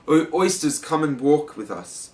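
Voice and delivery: lightly acted plea from the walrus; beseeching. A narrator's reading. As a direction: coax them along in a storybook voice. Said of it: "the walrus did beseech".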